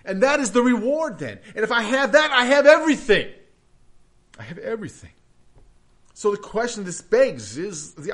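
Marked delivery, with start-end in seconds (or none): 1.5-3.3 louder, rising conviction, higher pitch; emphatic declaration. Real, not acted. Preacher proclaiming to his congregation, loud and emphatic. 4.4-5.1 softer, quiet restatement; soft echo. Real, not acted. Preacher repeating it softly to the congregation, quiet and tender.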